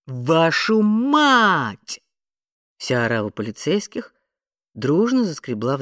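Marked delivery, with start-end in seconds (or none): 0.1-2.0 high, loud, long-drawn yell; stretched parting shout; shouted curse. A narrator's reading. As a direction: holler it out slowly into the distance. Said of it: "Рэнди крикнула".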